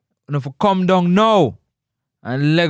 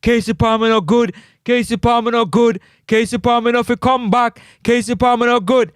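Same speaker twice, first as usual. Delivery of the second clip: mock fans; 6 semitones higher than usual. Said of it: "all of the fans them can say".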